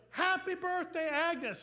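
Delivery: high, loud party shout; crowd's surprise greeting. Acted. Everyone greets Agnes at her surprise party, loud high shout. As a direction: call it out like a whole crowd. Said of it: "and everyone goes".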